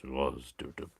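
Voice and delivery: hammed-up low French accent. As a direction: drop the voice to sound French. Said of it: "go down on a couple of octaves".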